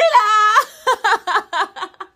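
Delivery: high, loud burst of laughter. Real, not acted. Vlogger laughing loudly after her welcome. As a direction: let a big laugh out.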